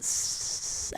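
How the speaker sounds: unvoiced hiss of air leaving a tire. Imitating the stabbed tire going flat. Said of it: "And all you heard was".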